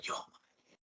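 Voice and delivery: breathless secret whisper. Retold or imitated. Whispering to her hero beside her on a panel, secret whisper. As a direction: hush it like a shy fan confessing. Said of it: "I had to whisper to her".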